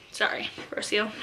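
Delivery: hushed apology under breath; whispered aside. Real, not acted. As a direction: whisper it quickly.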